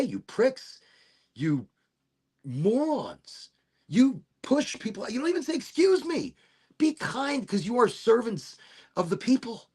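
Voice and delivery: retelling the rant he held back from the rude cops; mock indignant rant. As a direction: scold them like you wish you had.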